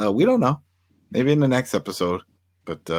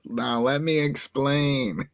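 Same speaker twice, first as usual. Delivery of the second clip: slow, higher, put-on explaining voice; mock patient explainer; 5 semitones higher, much slower than usual. Podcaster imitating her showing up to explain, mock patient. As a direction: spell it out slowly like a smug official.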